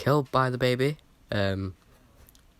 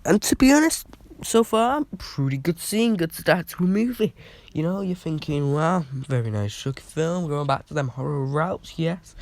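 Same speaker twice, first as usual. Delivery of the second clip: mock excitement; 9 semitones higher, 7 dB louder than usual. Sarcastic praise before weird twist.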